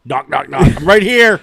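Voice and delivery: loud, high call through the wall. Pretend. Friend joking the patient called to the staff, loud high shout. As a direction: shout it out playfully from the room.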